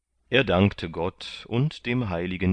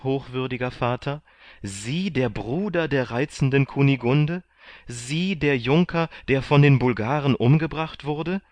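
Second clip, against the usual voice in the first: high-pitched, incredulous questioning; acted amazement, pitched well above narration; astonished disbelief; 7 semitones higher, 3 dB louder than usual. A narrator's reading. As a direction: pile up amazed questions, each lifted high. Candide astonished at rediscovering his friend.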